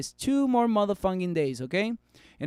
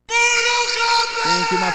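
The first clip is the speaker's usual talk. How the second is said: big high shout of thanks; much higher, louder cheer; excited shout; 6 dB louder, much slower than usual. Acted. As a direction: belt it out like a hype catchphrase. Catchphrase shout at viewers.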